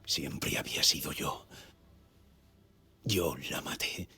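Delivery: hushed, brooding voice-over; hushed confession. Acted. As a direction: confess it under your breath, half whispered.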